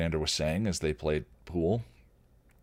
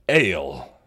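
a gleeful louder call for ale; happy troll yell; 7 dB louder than usual. Acted. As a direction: bark it out with joy. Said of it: "the troll yelled happily".

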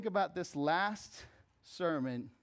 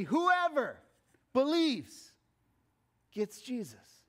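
preaching at full force; 7 semitones higher, 4 dB louder, slower than usual. Real, not acted. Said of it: "preaching climax".

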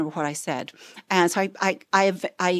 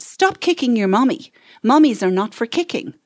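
put-on louder firm command to a child; bossy; 4 semitones higher, 6 dB louder than usual. Speaker ordering the kicking boy to stop, bossy and louder. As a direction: tell the kid off like you mean it. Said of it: "maybe a bit bossier".

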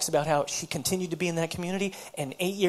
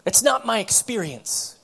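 higher, louder voice of an exasperated woman; frustrated retort; 6 semitones higher, 8 dB louder than usual. Retold or imitated. Woman rebuffing her counselor's quoted scriptures, frustrated retort. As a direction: push back hard, insisting on each phrase. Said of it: "she kinda slammed it down on the".

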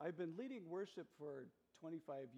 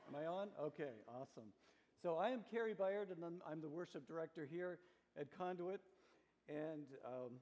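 ordinary talk

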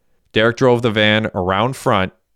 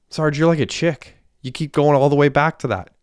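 higher-pitched, exasperated snap; Hecky firing back at Sarge, pitch raised; heated retort; 6 semitones higher than usual. Acted. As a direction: push back hard at him, voice up high. Hecky snaps at Derek.

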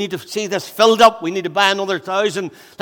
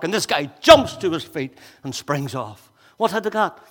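usual speaking voice

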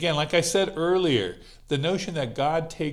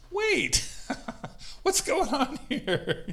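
much higher, laughing disbelief; a startled "wait, what?" that cracks into a laugh; baffled disciple; 13 semitones higher, slower than usual. Retold or imitated. Disciple baffled by Jesus crying.

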